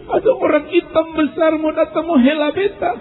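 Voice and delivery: very high-pitched acted panic; terrified. Dying man sees a dark figure coming, terrified.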